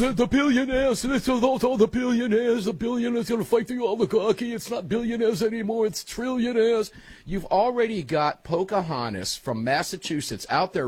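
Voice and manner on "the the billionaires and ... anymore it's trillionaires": much higher, louder Bernie Sanders rant; Bernie impersonation. Retold or imitated. Host mocking Bernie ranting at billionaires, high and loud. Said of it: "our Bernie impersonation".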